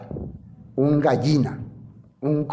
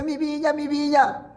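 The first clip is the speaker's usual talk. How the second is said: giddy Acab; 10 semitones higher than usual. Acted. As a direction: croon over the vineyard like a toy. Acab voiced, giddy over vineyard. (Acab feliz con su viña, voz aguda y suave)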